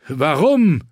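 high, loud, incredulous question; shouted demand. A narrator's reading. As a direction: cry out for an explanation. Jon demands why he was stabbed. (laut herausgerufene Frage)

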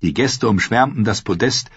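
ordinary delivery, nothing marked

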